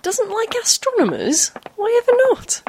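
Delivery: shocked, squeaking up high; outraged and disbelieving; indignant surprise. Acted.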